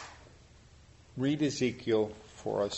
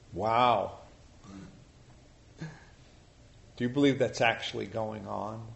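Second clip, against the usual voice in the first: ordinary talk, nothing marked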